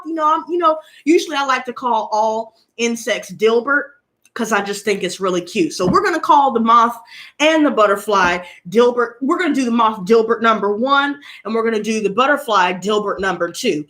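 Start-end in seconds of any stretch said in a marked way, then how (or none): none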